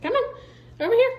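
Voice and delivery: high, urging call; calling the dog. Real, not acted.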